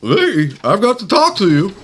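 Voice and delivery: high, jittery nervous comic voice; Don Knotts impression. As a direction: play it twitchy and flustered. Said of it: "play Don Knotts".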